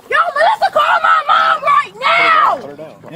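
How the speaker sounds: high, slow, yelling for help; desperate shout. Real, not acted. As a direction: scream for someone to call. Shouting for help during arrest.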